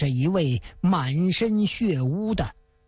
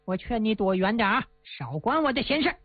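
higher, jeering thug voice, sped up at the end; arrogant villain; 3 semitones higher than usual. Acted. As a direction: brag and threaten like a spoiled bully. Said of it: "一阵的奸笑啊". (恶霸仗势欺人地嘲弄朱元璋)

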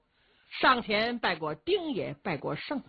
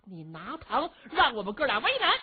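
much higher and louder outburst; angry shout; 9 semitones higher, 4 dB louder than usual. Acted. Brother told to hush at night, shouting back. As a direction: yell it out, refusing to calm down. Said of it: "您别嚷好不好".

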